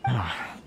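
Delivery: a long tired sigh; heavy resigned exhale; weary sigh. Acted. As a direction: breathe out the frustration. Merchant weighing the tax problem.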